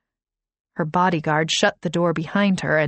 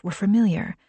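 usual speaking voice

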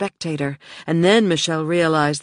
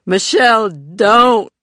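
loud desperate cry; 7 semitones higher, 10 dB louder, slower than usual. Acted. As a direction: call out to stop her, loud. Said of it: "Joey cried".

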